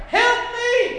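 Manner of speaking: high, loud desperate shriek; shriek for help. Acted. Preacher acting the Hebrew shriek to the Lord, desperate. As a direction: scream it out like someone drowning. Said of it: "they shrieked out".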